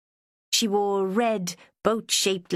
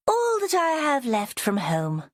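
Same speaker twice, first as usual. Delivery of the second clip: higher, posh aristocratic lady; 9 semitones higher than usual. A narrator's reading. Joanna showing Vera her glass, posh aristocratic lady. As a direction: speak like a sixties BBC aristocrat. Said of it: "She spoke like an aristocrat".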